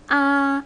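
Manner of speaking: "A" high, level and held; high first tone. Shown as a demonstration. Teacher has students repeat, high level "A". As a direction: sustain "A" on one high note. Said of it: "the higher tone".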